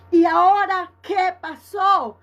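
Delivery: slow, loud, astonished; like hands on her head in disbelief; stunned nurse. Retold or imitated. (enfermera asombrada, lenta y fuerte)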